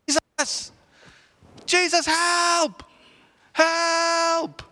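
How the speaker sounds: high, loud desperate call across a crowd; frantic father crying out for help, loud and high. Acted. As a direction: shout his name to be heard. Father calling through crowd.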